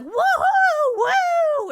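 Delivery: very loud, high-pitched "woohoo"; full-volume mock celebration; imitated cheering. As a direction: whoop like a rowdy family table. Imitating relatives cheering her arrival.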